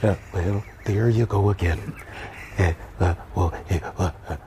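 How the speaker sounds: very low, soft, faltering statesman; halting presidential impersonation; Ronald Reagan. Acted. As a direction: stammer low through uhs and wells. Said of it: "I gotta hear the Ronald Reagan".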